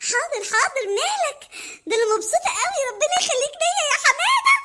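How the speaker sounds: gushing Mona. Acted. Mona thanking Hamada, high and doting. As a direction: gush over him.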